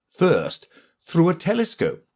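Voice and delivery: ordinary delivery, nothing marked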